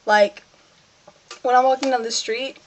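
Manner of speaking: normal talking voice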